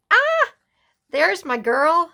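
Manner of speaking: high and loud delighted shriek of recognition; excited squeal. Real, not acted. Spotting her pen pal's photo in the journal, delighted squeal.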